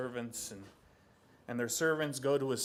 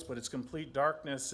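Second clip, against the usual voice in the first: ordinary delivery, nothing marked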